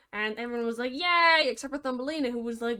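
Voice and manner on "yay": loud mock-happy cheer. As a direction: cheer like the crowd. Everyone cheers at engagement.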